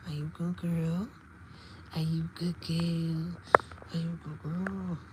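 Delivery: hushed coo, half whispered; soft pet talk. Real, not acted. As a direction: murmur softly to a pet. Soothing the cat.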